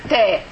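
ta said heavy with a rounded, pushed-out mouth. Shown as a demonstration. Teacher modelling the heavy Arabic letter. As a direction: press the tongue for a heavy ta. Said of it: "so this is the letter".

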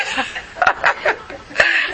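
high, breathless fit of laughter. Real, not acted. Teacher cracking up at her own joke, high helpless laugh.

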